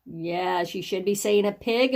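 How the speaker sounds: normal talking voice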